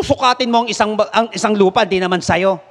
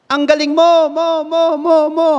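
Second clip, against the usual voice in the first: echo; 7 semitones higher, 3 dB louder than usual. Acted. Echo of praise.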